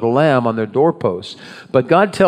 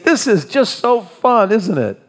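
high, sing-song fake cheer; mock happy travelers; 8 semitones higher than usual. Pastor mocks the Israelites as happy marchers, mock cheerful. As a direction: gush with sarcastic, put-on glee. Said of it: "Were they marching along with smiles on their face saying".

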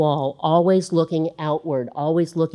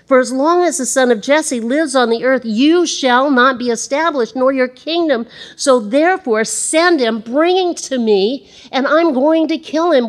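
higher, louder threat; furious Saul; 7 semitones higher, 4 dB louder than usual. Acted. Saul ordering Jonathan to bring David, furious. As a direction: demand his death in a rage. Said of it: "Saul was angry".

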